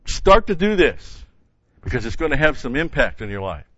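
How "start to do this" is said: urgent emphatic push; emphasised start. Shown as a demonstration. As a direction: start to do this pitched much higher, louder and slower. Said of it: "it puts the emphasis on the begin".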